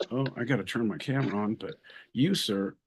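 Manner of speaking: ordinary talk, nothing marked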